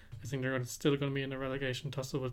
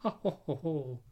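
hammed-up evil villain laugh; mustache twirling villain; 5 dB quieter than usual. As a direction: cackle like a cartoon villain. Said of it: "I'm twirling my mustache".

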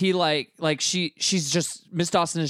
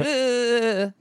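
disgusted ugh; 9 semitones higher than usual. Retold or imitated. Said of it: "just be like".

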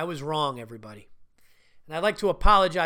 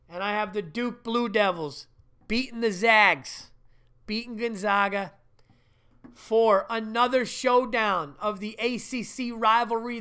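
normal talking voice

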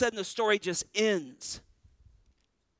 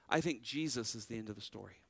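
much softer and lower, almost under the breath; a quiet, weighty confession; hushed, solemn; 8 semitones lower, 9 dB quieter, faster than usual. Real, not acted. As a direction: drop the voice low for "I think Jesus is the end of the story". Solemn conclusion of the sermon.

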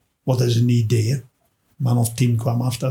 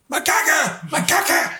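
high mock heckler cry, repeated; heckler's yell; 13 semitones higher than usual. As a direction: squawk it twice like the rude heckler. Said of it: "Zo, zo van het".

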